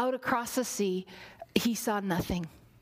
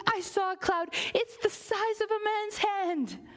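excited servant; 7 semitones higher than usual. Acted. Servant runs back excited.

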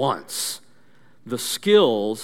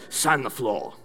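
low, softer sensei command; calm, quiet order from the master; Mister Miyagi; 4 semitones lower, 5 dB quieter than usual. Acted. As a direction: intone it low and firm. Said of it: "Mister Miyagi turns and he looks him".

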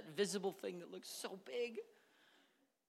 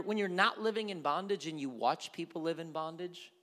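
ordinary delivery

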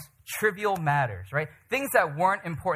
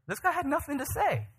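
pitched way up in amazement; high, incredulous exclamation; high-pitched incredulity; 11 semitones higher than usual. Real, not acted. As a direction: marvel at it in a high voice. First of two emphatic takes.

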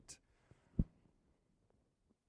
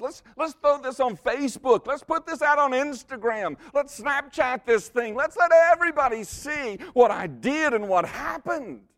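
high and loud hammed-up showing off; mock excited brag; 13 semitones higher, 8 dB louder than usual. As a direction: play the eager show-off posting everything. Mocking social media bragging.

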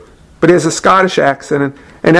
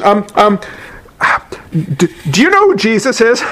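halting, higher-pitched, tongue-tied question; nervous stammering; 3 semitones higher than usual. Retold or imitated. Student nervously approaching a stranger to evangelize, stammering. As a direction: act out fumbling your rehearsed opener. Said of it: "said in this beautifully eloquent presentation".

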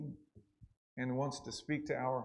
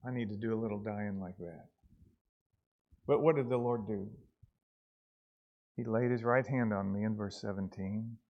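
normal talking voice

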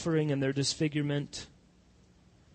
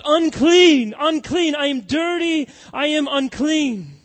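loud, high anguished cry of unclean; 11 semitones higher, 12 dB louder than usual. Acted. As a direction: cry it out like a leper at the gate. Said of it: "is to cry out".

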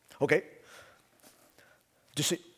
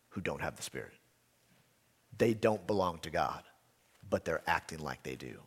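much softer and lower, confiding; hushed warning; 8 semitones lower, 9 dB quieter than usual. Acted. Pastor quietly warning of pretenders in the church, hushed. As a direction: lean in and warn them quietly.

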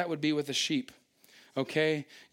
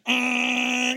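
mock frightened whimper; 7 semitones higher, 5 dB louder than usual. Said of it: "Now they're like".

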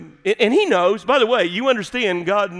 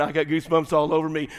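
ordinary talk, nothing marked

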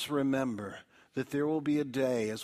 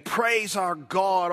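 louder, higher, slow call to praise; great voice; 6 semitones higher, 8 dB louder, slower than usual. Acted. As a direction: boom it like a voice from the throne. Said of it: "came a great voice".

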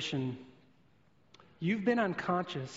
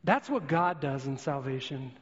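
usual speaking voice

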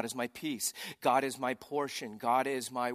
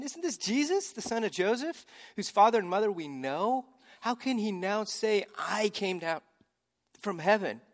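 high, indignant mocking of the crowd; grumbling crowd; 7 semitones higher, 4 dB louder than usual. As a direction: sneer it like the doubting crowd.

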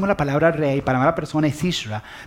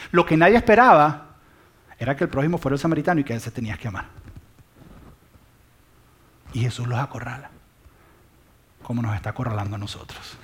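ordinary talk, nothing marked

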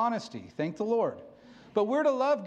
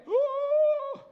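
1 overwhelmed ooh; 4 dB louder than usual. Retold or imitated. Overwhelmed by sudden emotions.

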